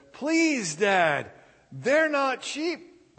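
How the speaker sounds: put-on high, loud teen begging, slowing on the last words; whiny pleading. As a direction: plead with Dad, dragging it out. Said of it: "the father said".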